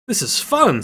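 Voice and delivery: high, loud and gleeful; a delighted kid shouting with glee; excited squeal. A narrator's reading. Fox thrilled by the slide, squealing with joy. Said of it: "says Fox".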